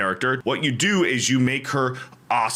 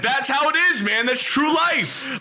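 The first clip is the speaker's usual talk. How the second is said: mock wise shout; 14 semitones higher than usual. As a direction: belt it out like a preacher of bro wisdom. Mock-wise punchline on the meme.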